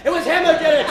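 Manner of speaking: ordinary talk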